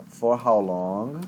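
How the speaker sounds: slow, careful English question; patient teacher modelling the question slowly; slow teacher model. Shown as a demonstration. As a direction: spell it out slowly for the student. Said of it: "Mas eu perguntei assim, ó".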